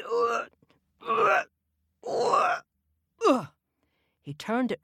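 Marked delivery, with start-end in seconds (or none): 0.0-3.5 high strained effort sounds; lifting a whole house; straining grunts. A narrator's reading. Giant straining to lift house.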